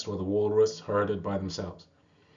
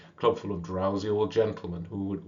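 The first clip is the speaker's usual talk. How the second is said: ordinary delivery, nothing marked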